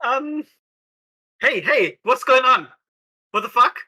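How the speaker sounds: ordinary talk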